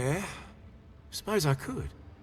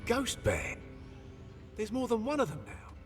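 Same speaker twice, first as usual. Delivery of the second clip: higher-pitched disbelief; alarmed surprise; 5 semitones higher than usual. Acted. Crewman hearing of another clan, alarmed. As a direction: react to unwelcome news. Hears of a second invading clan.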